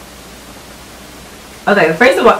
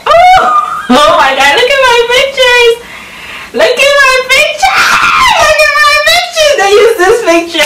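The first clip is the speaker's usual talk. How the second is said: real overwhelmed excitement; tearful joy. Real, not acted. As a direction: gush through happy tears. Overjoyed seeing her pictures.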